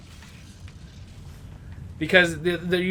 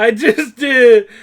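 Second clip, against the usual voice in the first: laughing; 7 semitones higher, 12 dB louder, slower than usual. Real, not acted. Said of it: "Yeah, that's, that's a camel".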